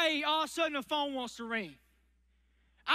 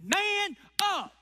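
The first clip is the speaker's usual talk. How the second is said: a barked coach command, pitched higher and louder; gruff coach; 5 semitones higher, 3 dB louder than usual. Retold or imitated. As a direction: bark it like a wrestling coach. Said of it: "The wrestling coach looks at the wrestler and says".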